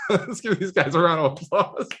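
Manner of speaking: high, laughing through the words; laughing call for applause. Real, not acted. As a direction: laugh as you rally the crowd to clap. Laughing while calling for applause.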